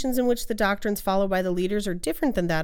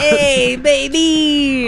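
put-on New York accent; 4 semitones higher, 4 dB louder, much slower than usual. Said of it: "bad New York accent".

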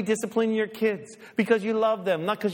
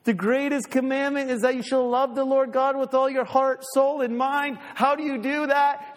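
normal talking voice

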